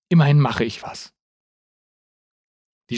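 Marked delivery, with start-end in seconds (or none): none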